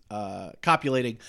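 ordinary talk

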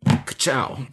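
Lightning McQueen. Retold or imitated. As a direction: shout Ka-chow like the race car.

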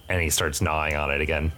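ordinary talk, nothing marked

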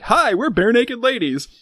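bright, slow, drawn-out ad hello; like a band greeting in a commercial; chirpy ad greeting. Retold or imitated. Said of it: "trying to all say it together".

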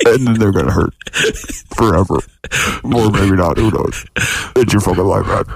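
cold rasping evil emperor voice; Dark Lord. Pretend. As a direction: menace him like a villain. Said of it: "Sounds like the Dark Lord".

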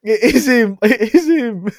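real thrilled recognition; excited confirmation. Real, not acted. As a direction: shout it out as the proof lands. Confirming actor in clip.

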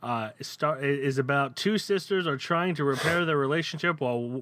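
ordinary talk

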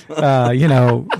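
ordinary talk, nothing marked

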